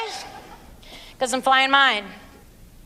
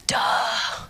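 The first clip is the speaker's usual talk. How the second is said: whispered, deadpan duh; sarcastic whisper; 3 semitones lower, 4 dB quieter than usual. Real, not acted. Answering the cheated wife, sarcastic whisper. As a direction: drop it dry and breathy.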